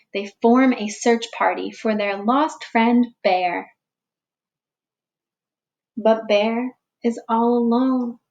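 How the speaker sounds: put-on slow, expressive read-aloud for young children; storybook reading. A narrator's reading. Teacher reading a picture book to the class, gentle storytelling. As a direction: read it like story time for little ones.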